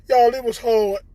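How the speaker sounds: ordinary delivery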